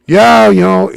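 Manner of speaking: high, loud, imitated eager yes; acted excitement. Friend confirms they are moving down, mock excited shout.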